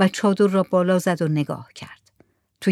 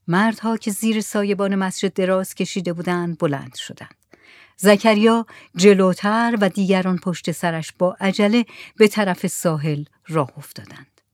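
usual speaking voice

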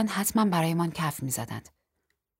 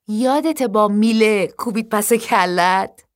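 high, loud, slow taunt; gleeful drawn-out reminder; laughing teasing; 4 semitones higher, 7 dB louder, slower than usual. Acted. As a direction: tease through amusement, stretching the words. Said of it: "بعد با خنده".

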